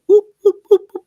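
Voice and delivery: high, loud "whoop" pokes; quick high-pitched whoops for jabbing; imitated poking noise. Analyst mimes America being poked like a bear, high playful whoops.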